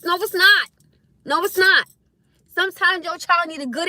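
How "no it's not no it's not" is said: high, loud, insistent denial; emphatic rebuttal. Real, not acted. Parent answers child abuse claims, emphatically rebutting. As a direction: shut the claim down hard.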